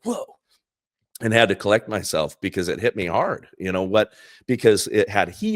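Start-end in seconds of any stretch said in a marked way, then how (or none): 0.0-0.4 choked whoa. Retold or imitated. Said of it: "burst into surprising tears".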